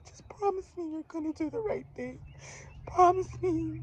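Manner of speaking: very high, teary pleading; crying mother again. Retold or imitated. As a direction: imitate her sobbing plea, pitched high. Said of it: "that's how she was sounding to me".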